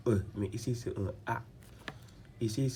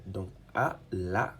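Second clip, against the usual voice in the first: ordinary talk, nothing marked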